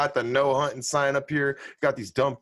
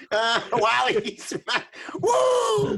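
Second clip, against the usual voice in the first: high whooping laughter; real laughter cutting off the words; laughing hard; 9 semitones higher, slower than usual. Real, not acted. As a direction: crack up and whoop. Reacting to funny story.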